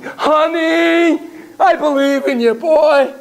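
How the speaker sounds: high, loud, doting parent voice; encouraging parent. Retold or imitated. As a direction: gush it like a proud mother. Imagined parent encouraging their child.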